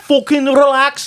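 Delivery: high, slow northern English snap; mock Scouse. Friend joining the yoga bit, mock Scouse accent. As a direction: bark relax like a yob.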